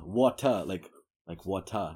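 "water" sounded out as "waw-tah"; New York "water" with no R at the end. Shown as a demonstration. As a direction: draw out the final "ah" in "water". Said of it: "probably ended with A".

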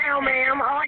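loud, over rushing water. Real, not acted. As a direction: call it out over the noise. Caller answers loudly over water.

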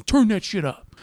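mock demand. Boyfriend repeating his order to the driver, high snap. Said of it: "he goes".

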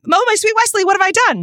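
high-pitched mock lament; melodramatic heroine. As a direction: wail it like a storybook damsel. Acting out a film quote clue.

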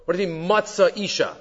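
ordinary talk

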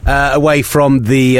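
ordinary talk

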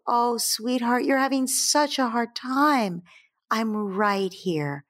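slow, drawn-out comforting parent; tender sympathy. Acted. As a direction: soothe an upset child, lingering on the words. Parent comforts an upset child.

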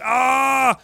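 high mock yelp of pain; mock scream. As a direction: yelp it out high like the snap just hurt. Opponent screaming.

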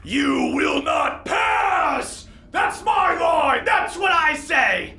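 high, strained giant's bellow; shouting hill giant. Acted. Hill giant in a three-way argument, shouting. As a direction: roar slowly like an arguing hill giant. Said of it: "some kind of hill giant, going".

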